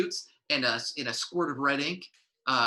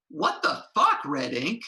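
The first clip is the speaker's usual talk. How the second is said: very high, loud outraged yell; furious shriek; 12 semitones higher, 4 dB louder than usual. Acted. [name] yelling at [name] over her taco stand, furious. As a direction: scream it, betrayed.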